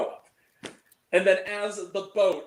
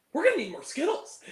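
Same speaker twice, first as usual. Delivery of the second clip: imitated movie line; 8 semitones higher than usual. Acting Grace Curry's camera-winking line.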